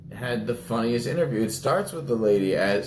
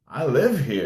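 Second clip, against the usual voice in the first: deadpan reply; 5 semitones higher, 4 dB louder than usual. Retold or imitated. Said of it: "looks over at her and goes".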